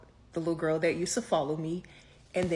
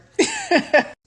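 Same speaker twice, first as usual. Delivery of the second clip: loud high laugh; 11 dB louder than usual. Real, not acted. Laughs at own story.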